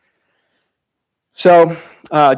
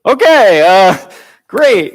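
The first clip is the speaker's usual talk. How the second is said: high, louder, hammed-up okay great; mock satisfied reply; 4 semitones higher, 6 dB louder, much slower than usual. Counselor mocking a useless yes answer, hammed-up. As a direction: play the counselor pretending that answer helps.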